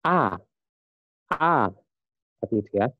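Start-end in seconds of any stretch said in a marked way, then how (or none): 0.0-0.3 A starting high and dropping sharply; falling tone A. Shown as a demonstration. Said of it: "turun ke bawah". 1.3-1.7 high A falling steeply. Shown as a demonstration. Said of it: "turun ke bawah".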